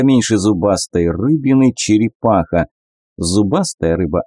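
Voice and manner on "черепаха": ordinary delivery, nothing marked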